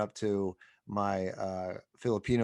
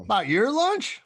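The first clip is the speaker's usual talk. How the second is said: a friend reacting in disbelief to a nosy question; incredulous; 10 semitones higher, 4 dB louder than usual. Real, not acted. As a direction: pitch leaps up in disbelief. Incredulous reaction to retold quote.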